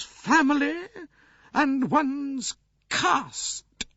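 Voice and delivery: very high, slow, proud grande dame; imperious old princess, unrepentant. A narrator's reading. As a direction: declare it slowly and firmly as an old princess.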